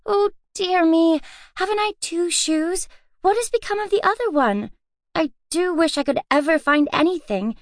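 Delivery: put-on high, hurried flustered girl; flustered young girl. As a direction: rush around hunting for it. Girl frantically hunting a shoe.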